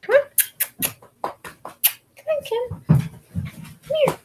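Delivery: coaxing the dog. Real, not acted. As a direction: coo his name up high. Coaxing dog onto her lap.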